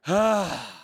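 long, heavy exasperated sigh; deep sigh. Shown as a demonstration. Pastor demonstrating Jesus's deep sigh, heavy and exhausted. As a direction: let out a weary breath of hot air. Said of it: "sighed deeply".

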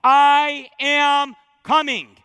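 slow, loud, ringing proclamation; emphatic declaration. Acted. Preacher booming Jesus's promise to the congregation, slow and loud. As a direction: proclaim every word as a promise. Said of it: "Three times in the epilogue".

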